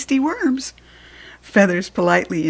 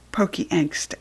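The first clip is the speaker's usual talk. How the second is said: usual speaking voice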